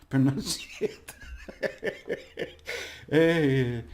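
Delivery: cracking up on the word; stumble, then burst into a wheezy laugh. Real, not acted. Teacher amused at failing to say pronunciate, laughing. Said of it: "it's funny. You have to laugh".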